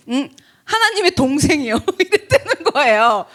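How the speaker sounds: words shaken by laughter; can't stop laughing while quoting; laughing retelling. Real, not acted. Mother laughing at the boy's odd answer. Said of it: "처음 들어봤어요".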